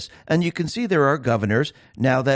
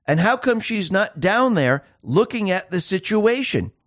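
ordinary delivery

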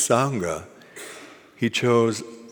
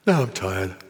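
acted weariness. Raven ends Badger's questions, acted weary sigh. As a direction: sigh out heavily after the words.

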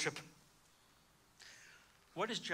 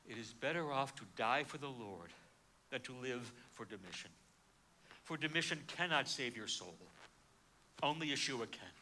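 solemn conviction; 6 semitones lower, 7 dB quieter than usual. Retold or imitated. John's solemn quoted conviction.

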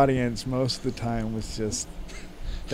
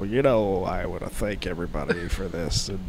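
quiet, low, mumbled thanks; mild imitation of a soft-spoken acceptance speech; 6 semitones lower, 7 dB quieter than usual. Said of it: "he would just go".

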